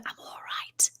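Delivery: hushed, barely audible retold message; whispered. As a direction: imitate dad's very faint whisper. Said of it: "he said it in a whisper".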